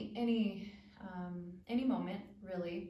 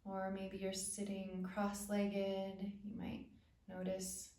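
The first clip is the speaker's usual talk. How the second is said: calm, spacious phrases with long pauses; unhurried body-awareness meditation voice; guided meditation. Acted. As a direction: guide attention gently through the body.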